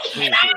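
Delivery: shrill panicked shriek; panicked high scream. Acted. DM screams Peabody as requested.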